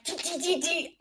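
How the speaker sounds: high, soft rapid tapping syllables; squeaky little key-tap noises; mimicking keyboard typing. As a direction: imitate frantic typing in a tiny high voice. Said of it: "on that keyboard".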